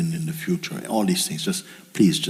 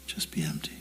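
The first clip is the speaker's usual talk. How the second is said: guided meditation; 5 dB quieter than usual. Acted. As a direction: let each word sink in softly. Guides listeners to empty the mind.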